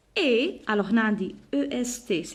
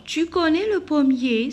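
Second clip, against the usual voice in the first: pitched-up teacher's question; question intonation; 6 semitones higher, 4 dB louder than usual. Shown as a demonstration. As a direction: read "tu connais le pommier" as a lifted, deliberate question. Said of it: "c'est une question".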